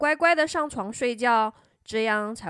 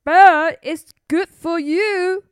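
loud, slow, higher-pitched urging; firm grown-up character; 5 semitones higher, 9 dB louder, slower than usual. Acted. Doctor telling princess bed is good, firmly. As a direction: lay it down loudly and slowly for a child.

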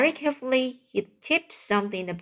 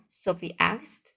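ordinary delivery

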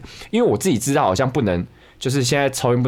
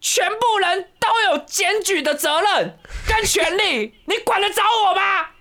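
much higher mock yell of a street stranger; hammed-up high-pitched scolding, slow and drawn out; crazy woman yelling; slower than usual. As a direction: squeal it way up like the unhinged lady scolding.

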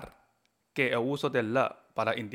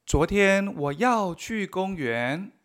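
very slow careful Chinese; slow model sentence; 4 semitones higher, much slower than usual. Shown as a demonstration. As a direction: read it word by word. Model sentences read slowly. (frase modelo muy lenta)

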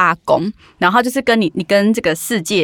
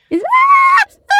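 high-pitched mock scream; 4 dB louder than usual. Retelling how she screamed in the truck, high mock scream. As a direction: let out a sudden high shriek, half in jest. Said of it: "这样尖叫".

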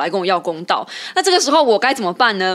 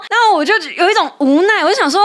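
high, louder helpless exasperation; exasperated; 7 semitones higher, 3 dB louder than usual. Real, not acted. Host telling co-host how helpless she felt, exasperated. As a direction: plead with a sigh, please stop this.

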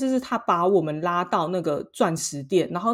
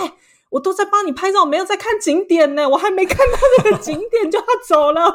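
high, louder aggrieved whine; mock whiny complaint; 12 semitones higher, 8 dB louder than usual. Imitating her own complaint to the companion who only took photos, mock whiny. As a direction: protest in a put-upon tone.

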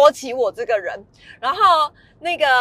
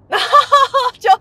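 laughing; 12 semitones higher, 8 dB louder, slower than usual. Real, not acted. Awkward silence after birthday wish.

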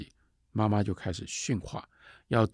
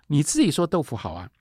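put-on a louder, higher protest from a cross little girl; angry girl; 7 semitones higher, 5 dB louder than usual. Little girl protests to the nanny, mildly angry. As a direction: talk back like a cross child. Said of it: "这样小孩女孩很气啊".

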